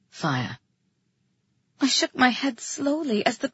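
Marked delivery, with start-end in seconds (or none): 0.1-0.6 one low, soft word; quiet, grave male voice; under his breath. A narrator's reading. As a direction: murmur it deep and hushed. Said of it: "nearly under his breath".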